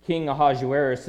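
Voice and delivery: normal talking voice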